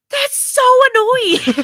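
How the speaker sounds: loud, high, drawn-out whine; exasperated outburst. Retold or imitated. Parent fed up with a child changing her mind, exasperated. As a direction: wail it in mock exasperation.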